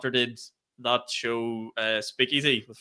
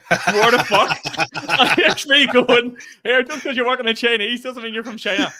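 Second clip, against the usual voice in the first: high, loud and laughing all through; cracking up telling the podcast host; laughing disbelief; 10 semitones higher, 7 dB louder than usual. Real, not acted. Laughing in disbelief while retelling to the host.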